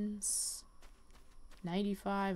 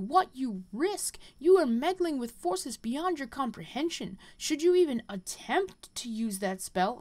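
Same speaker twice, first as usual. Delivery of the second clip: louder and higher, a furious stern rebuke; outraged master threatening banishment; angry scolding elder; 6 semitones higher, 6 dB louder than usual. Acted. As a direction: tear into the girl for her recklessness. Mentor scolds reckless pupil.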